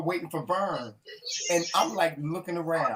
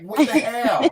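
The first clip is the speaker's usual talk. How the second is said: laughing surprise; 5 semitones higher, 5 dB louder, much slower than usual. Retold or imitated. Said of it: "I was like".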